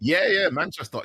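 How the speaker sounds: imitating a Manchester speaker saying Manchester; playful mock Manchester accent. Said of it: "What about Manchester?".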